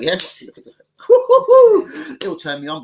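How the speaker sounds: high and loud laughing squeal; laughing mock challenge. Facing twenty geezers outside, squealing with laughter. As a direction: cackle at the threat. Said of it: "I'm like".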